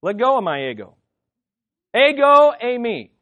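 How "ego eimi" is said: Ego punched loud and high, eimi dropping back; emphatic Greek phrase. Shown as a demonstration. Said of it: "here's how you say it".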